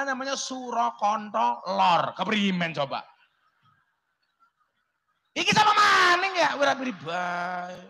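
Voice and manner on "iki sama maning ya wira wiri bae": loud joking outburst. Acted. Joking outburst at village name.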